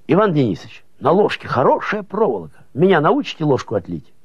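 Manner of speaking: put-on high-pitched eager kid voice; eager young boy. A narrator's reading. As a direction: pipe up high with a boy's request. Boy eagerly reporting in story.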